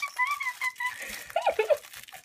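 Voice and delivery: shrill giddy laughter; giddy high laugh. Real, not acted. Fan laughing in glee while jumping up and down.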